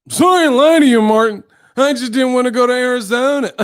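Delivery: loud, high exaggerated kid mimicry; mocking the boy. Martin mocking the boy's apology, loud high mimicry. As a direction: whine it back high, breaking into laughter. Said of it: "he would mock the people's voices".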